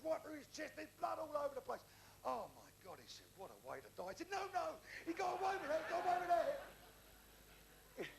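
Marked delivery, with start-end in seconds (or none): 2.3-3.0 low, stunned murmur; mock hushed horror. Mate reacts to horrific accident. 3.4-4.1 low, soft sympathy; quietly acted mourning; mock hushed dismay. Mate mourns the friend. 4.3-8.1 high, loud, stretched-out correction; escalating comic insistence; insistent denial. Acted. Insists louder that friend survived.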